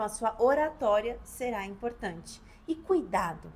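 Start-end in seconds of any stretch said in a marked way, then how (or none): none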